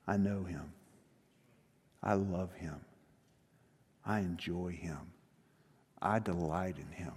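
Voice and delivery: low, soft, heartfelt confession; tender sincerity. Acted. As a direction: let each phrase settle quietly and low.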